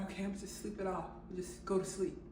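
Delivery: soft, quick murmur to oneself; quiet self-talk. Retold or imitated. Talking herself into sleeping.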